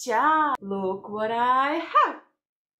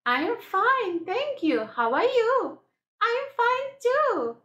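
high-pitched pretend duck voice; chirpy little duck chat, pitched well up; 11 semitones higher, faster than usual. Little duck answers how it is, high voice. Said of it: "little duck?".

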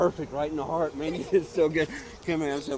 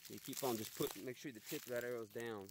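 hushed tracking; 2 semitones lower, 19 dB quieter, faster than usual. Real, not acted. Quietly tracking blood trail.

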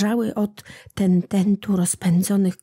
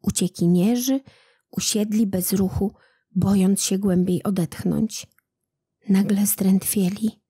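usual speaking voice

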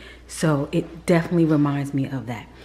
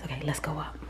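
whisper; 11 dB quieter, much faster than usual. Real, not acted. Whispered aside.

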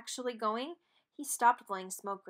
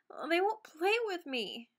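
whiny; 7 semitones higher than usual. Acted. Dexter whining.

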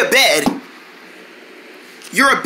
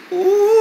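long, high sustained "ooh"; pretend blooming noise; 9 semitones higher than usual. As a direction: hold a high note like a flower opening. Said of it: "All I do is bloom like this".

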